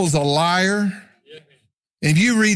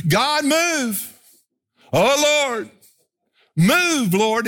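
higher, urgent plea to God; mock impatient prayer, higher; pleading with God; 7 semitones higher, much slower than usual. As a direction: beg God to hurry up, a touch hammed. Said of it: "we're going".

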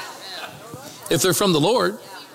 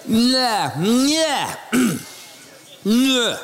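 swollen-tongue bleh sounds; tongue-tied babble; 3 semitones higher, much slower than usual. Retold or imitated. Pastor suddenly unable to talk, thick tongue-tied babble. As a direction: babble like your tongue swelled. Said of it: "like his tongue got swollen".

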